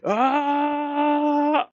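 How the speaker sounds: high hammed-up charging yell; mock battle yell. Hero charging the Orc King, a high hammed-up battle yell. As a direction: yell to pump yourself up as you charge in. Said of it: "like, yelling and he's".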